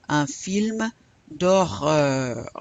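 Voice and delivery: D'HORREUR slow, both French Rs clearly sounded; slow teacher's model of "d'horreur", French R held; audible French R. Shown as a demonstration. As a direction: let the final R of "d'horreur" be heard. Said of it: "I wanna hear that R".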